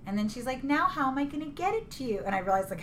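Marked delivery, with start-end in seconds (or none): 0.6-2.2 flirty voice. Retold or imitated.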